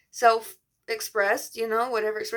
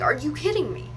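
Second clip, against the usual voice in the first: incredulous, raised pitch falling at the end; retold disbelief; 6 semitones higher than usual. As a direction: re-enact an exasperated question. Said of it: "being like".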